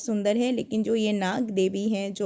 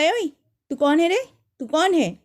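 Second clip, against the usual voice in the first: calling out challenge; 6 semitones higher than usual. Retold or imitated. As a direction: challenge the ghost to name itself. Confronting the ghost in her room.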